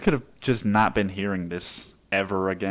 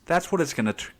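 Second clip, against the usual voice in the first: ordinary talk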